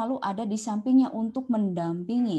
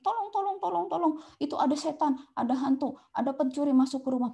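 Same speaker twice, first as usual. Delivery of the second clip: high, frightened cries for help; panicked cries; 6 semitones higher, faster than usual. Retold or imitated. Confused elder panicking about a ghost, high frightened cries. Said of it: "Misalkan dia panik".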